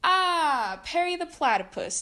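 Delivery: high, loud theatrical greeting; villain cry. Acted. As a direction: greet the nemesis with hammy flair. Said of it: "Doctor Doofenshmirtz cried".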